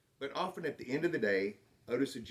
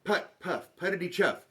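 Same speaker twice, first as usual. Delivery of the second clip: growing tractor putter; 6 semitones higher, 4 dB louder than usual. A narrator's reading. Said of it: "The sound became louder".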